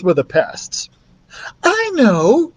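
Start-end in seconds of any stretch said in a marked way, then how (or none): 1.6-2.5 high, sudden bright idea; sudden exclaim. Acted. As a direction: blurt out the idea. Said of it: "exclaimed Betty suddenly".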